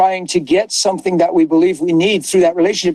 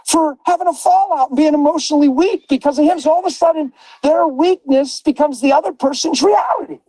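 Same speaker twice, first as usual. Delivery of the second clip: high, driving preacher's cry with shouted peaks; preaching at full force; 7 semitones higher than usual. Acted. Preacher driving the point home to listeners, fervent and raised. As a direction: lift the room like a pulpit.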